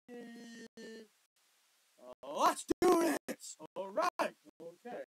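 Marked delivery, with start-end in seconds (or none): 2.4-4.4 loud enthusiastic yell. Real, not acted. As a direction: yell it out, full volume. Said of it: "Didn't need to yell".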